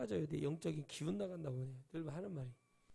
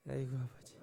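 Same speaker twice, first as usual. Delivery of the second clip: laughing sigh; 3 semitones lower than usual. Real, not acted. Mocking laugh at false pastor.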